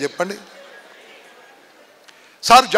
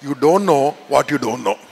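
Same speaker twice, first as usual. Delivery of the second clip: each word set apart, much lower and slow; slow, low repeat; 8 semitones lower, much slower than usual. Shown as a demonstration. Speaker to students, repeating the riddle, slow and low. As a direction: spell the riddle out slowly so it sinks in. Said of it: "మళ్ళీ చెప్తా".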